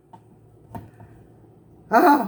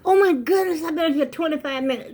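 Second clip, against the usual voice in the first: gasp, then high, loud alarm; hammed-up astonishment, pitched way up; mock shock; 9 semitones higher, 3 dB louder than usual. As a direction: gasp as if appalled at the time. Mock shock at time spent talking.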